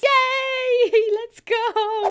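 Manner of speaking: high, loud, giddy cheer; jumping-up-and-down cheer; excited squeal. Real, not acted. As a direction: squeal with delight as it begins. Trailer about to start, cheering.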